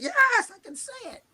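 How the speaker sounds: relieved, high burst answering a friend's question; relieved outburst. Retold or imitated. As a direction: finally let the admission burst free. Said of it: "and I finally were like".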